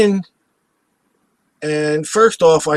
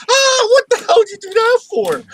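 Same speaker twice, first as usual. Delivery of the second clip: much higher, loud shocked outburst; retold shocked yell; 4 dB louder than usual. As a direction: shriek it at a driver who slammed the brakes. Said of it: "And I'm like".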